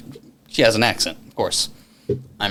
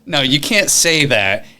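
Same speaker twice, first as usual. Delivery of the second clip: louder, higher pushy challenge; excited and aggressive; 8 semitones higher, 5 dB louder than usual. Retold or imitated. Pressing his date to talk about cocaine, worked up. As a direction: insist she own what she brought up. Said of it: "excited and aggressive".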